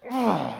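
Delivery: high loud groan; groan of regret. Retold or imitated. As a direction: groan at your own blunder. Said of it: "I was just like".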